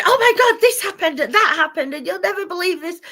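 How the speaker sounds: loud, high gushing exclamation; excited outburst. Pretend. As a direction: blurt it out loud and high, bursting with passion. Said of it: "as if I'm sat here, like, going".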